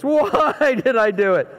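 lightly hammed-up self-reproach; remorseful child wail. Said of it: "That's real repentance".

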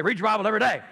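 put-on parroting voice; obnoxious mocking. Answering a friend's question with a teasing catchphrase. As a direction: needle him with the catchphrase. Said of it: "So I'm just being obnoxious".

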